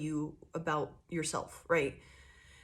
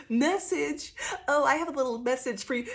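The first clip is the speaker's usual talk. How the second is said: mock smug messenger; 7 semitones higher than usual. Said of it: "They're like".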